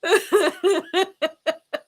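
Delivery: helpless high burst of laughter; cracking up at two chat friends; real laughter. Real, not acted. Host cracked up by friends, laughing hard. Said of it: "you two are killing me".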